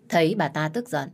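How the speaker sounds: ordinary delivery, nothing marked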